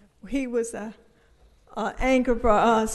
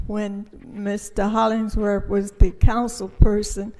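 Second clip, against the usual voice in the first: ordinary talk, nothing marked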